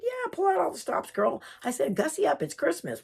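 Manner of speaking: high, sassy encouragement; playful egging on. Retold or imitated. Urging Jan to dress up for Christmas, playful high encouragement.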